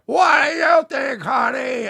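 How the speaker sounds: high, loud, slow sassy host voice; drag queen on mic. Retold or imitated.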